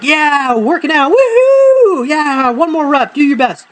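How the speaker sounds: high-pitched whooping encouragement; gym partner cheering. Retold or imitated. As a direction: hype up your workout buddy with a whoop. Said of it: "trying to be the good gym partner".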